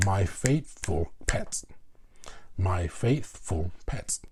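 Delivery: slow, each syllable of "My faithful pet" bounced apart; slow syllable count. Shown as a demonstration. Teacher counting four syllables for students, slow and separated. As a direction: count out the beats slowly. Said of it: "Example of four syllables".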